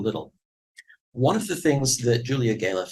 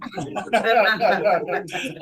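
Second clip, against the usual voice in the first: amused laugh; 7 semitones higher, 3 dB louder than usual. Real, not acted. Speaker laughs at own joke.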